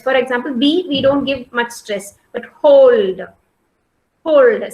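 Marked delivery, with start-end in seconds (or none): 2.5-4.7 "hold" pushed out loud, falling at the end; stressed syllable. Shown as a demonstration. Teacher showing students stress, "hold" said loud and forcefully. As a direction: put firm pressure on "hold". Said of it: "I am just giving some stress".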